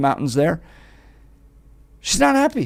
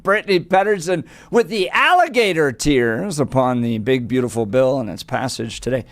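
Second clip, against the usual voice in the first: usual speaking voice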